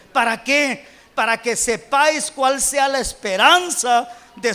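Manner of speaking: loud, emphatic declamation of scripture; full-force scripture preaching. Real, not acted. Preacher declaiming Paul's prayer to the congregation, forceful preaching. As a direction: proclaim the apostle's prayer with rising force.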